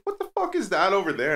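high-pitched incredulous question; mock astonishment. Podcast guest spots something off to the side, imitated astonishment. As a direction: act out someone suddenly puzzled, voice jumping up.

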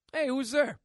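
a comic high yelp of surprise; startled call. Retold or imitated. Kylo arrives at temple door.

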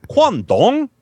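high, loud GUANGDONG swooping down and up; exaggerated Chinese tones. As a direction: sing out GUANGDONG like the recording. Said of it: "Listen to the pronunciation".

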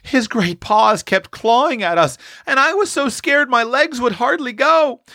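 put-on high, piping little girl's voice; scared small child, pitched very high. A narrator's reading. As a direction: pipe it up like a frightened child. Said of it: "piped out".

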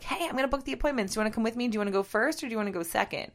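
fast, bright, eager booking chatter. Retold or imitated. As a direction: rattle it off excitedly. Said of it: "when I called and was like".

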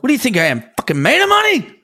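exasperated dad. Pretend. Voicing the dad ranting about money.

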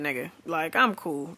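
ordinary talk, nothing marked

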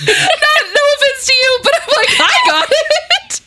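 very high, squealing laugh-talk; cracking up mid-sentence; real laughter. Real, not acted. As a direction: tease through a fit of giggles. Teasing co-host, laughing.

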